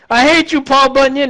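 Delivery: shrill, high-pitched cry of rage; hammed-up hateful shriek; mock furious yell. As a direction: scream it up high at the giant. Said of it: "and he's like".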